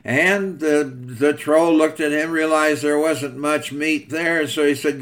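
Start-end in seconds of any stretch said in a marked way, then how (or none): none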